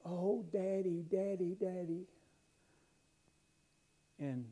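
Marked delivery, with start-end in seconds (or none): 0.0-2.0 put-on slow, higher plea of a hurt child; child crying out. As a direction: call for Daddy like a little girl in pain. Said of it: "she cried out".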